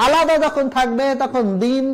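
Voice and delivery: ordinary delivery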